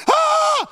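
very high, loud re-enacted scream; yelling scream. Boy runs for the big light, screaming in panic. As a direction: shriek like a panicked kid. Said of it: "I'm yelling".

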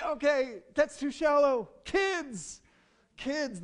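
high, loud mock enthusiasm; eager. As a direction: call out to the next hope. Acted switch to next hope.

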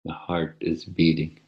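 soft, unhurried meditation voice; soft spa whisper. Acted. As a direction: ease them gently into stillness. Leads a guided meditation.

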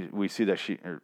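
ordinary talk, nothing marked

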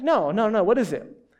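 ordinary talk

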